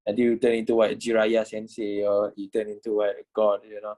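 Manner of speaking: ordinary delivery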